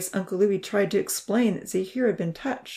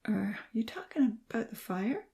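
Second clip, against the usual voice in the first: soft, half-whispered drowsy question; sleepy, soft and a little high; 4 semitones higher, 6 dB quieter than usual. A narrator's reading. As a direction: ask it barely awake. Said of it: "In a sleepy voice".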